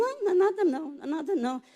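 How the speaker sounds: hurried denial, starting high; dismissive reassurance. Retold or imitated. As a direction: brush off the worry. Brushing off her worried son.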